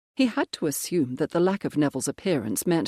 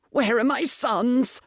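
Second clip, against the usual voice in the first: higher, slow, straining call for his sons; desperate cry; 8 semitones higher, 3 dB louder, slower than usual. Acted. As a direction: cry out for help, panicked. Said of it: "started to gasp for breath".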